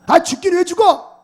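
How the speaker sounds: loud, high protest to brother; retold protest. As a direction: burst out against his talk of dying. (형에게 버럭 항의하듯)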